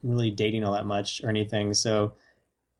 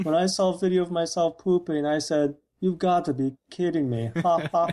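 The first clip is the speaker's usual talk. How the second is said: Tommy Wiseau impression; 5 semitones higher than usual. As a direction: deliver it with a wooden, flat-out fake chuckle like a bad actor. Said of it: "actually is Tommy Wiseau".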